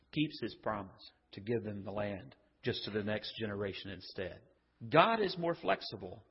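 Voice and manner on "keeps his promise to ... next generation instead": hushed. Real, not acted. Low, hushed preaching.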